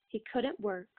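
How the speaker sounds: plain reading voice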